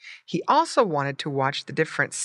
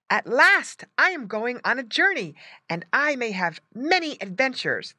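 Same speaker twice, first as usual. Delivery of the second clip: higher-pitched, eager piglet voice; excited little pig; 8 semitones higher, 3 dB louder than usual. A narrator's reading. Little pig setting off on a journey, excited. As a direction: think it with eager anticipation.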